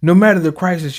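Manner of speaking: ordinary talk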